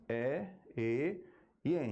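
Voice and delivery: E open, E closed, En nasal, slowly one by one; three E sounds. Shown as a demonstration. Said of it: "eu tenho três sons diferentes". (E aberto, E fechado e En nasal, devagar)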